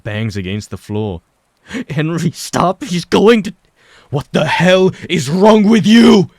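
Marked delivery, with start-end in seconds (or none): 1.9-3.5 high, loud cry to stop him; desperate shout. A narrator's reading. Mike shouts at Henry to stop, high and loud. 4.1-6.3 loud, slow, high furious yell; furious demand. A narrator's reading. Henry demands to know what is wrong with Mike, furious and loud. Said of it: "Henry demands".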